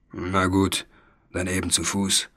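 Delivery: a hint of a grumbling dragon, low and soft; lightly growled. A narrator's reading. Dragon giving up on flying, a low mild growl. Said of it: "knurrte der Drache".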